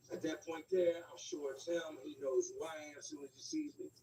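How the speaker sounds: ordinary delivery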